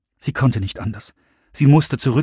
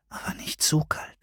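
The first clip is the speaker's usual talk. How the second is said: sehr leise, tonlos geflüstert; Flüstern; 4 semitones higher, 11 dB quieter than usual. Acted. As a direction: sanft widersprechen im Flüsterton. Madonna widerspricht flüsternd. (Madonna flüstert sanft dagegen)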